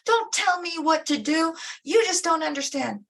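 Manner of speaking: high, loud indignant wail; screaming narcissist. Retold or imitated. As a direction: play the wounded narcissist yelling back. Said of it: "they're saying".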